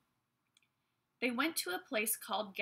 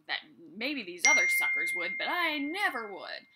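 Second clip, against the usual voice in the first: Peter, brash and bold; 9 dB louder than usual. Retold or imitated. Peter boasting he beats the other disciples, brash and loud. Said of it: "Peter's being all brash and bold".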